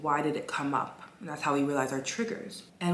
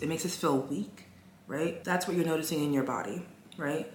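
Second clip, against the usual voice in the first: ordinary delivery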